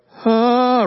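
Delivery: sudden high, loud huh of shock; stunned huh. Retold or imitated. Parents hearing the phone call, a shocked high huh. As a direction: blurt it out, caught off guard by the news. Told daughter may have leukemia.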